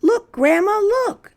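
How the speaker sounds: very high excited call; children shouting. Acted. Children to Grandma, high and excited. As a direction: point it out eagerly. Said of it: "shouted the children".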